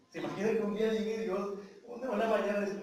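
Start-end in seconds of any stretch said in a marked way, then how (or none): none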